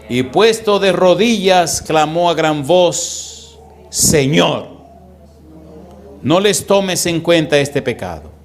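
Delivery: slow, weighty reading with each phrase stretched; solemn scripture reading. Acted. Preacher reading Stephen's plea aloud to the congregation, slow and solemn. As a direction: read it out as if proclaiming to the whole church. Reads Stephen's dying prayer.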